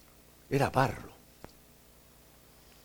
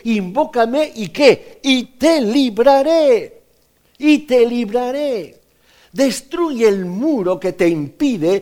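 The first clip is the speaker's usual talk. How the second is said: loud, high, impassioned preaching; fervent sermon delivery, higher and louder; full-force preaching; 8 semitones higher, 7 dB louder than usual. Acted. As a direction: proclaim it to the congregation. Preacher proclaiming God's promise.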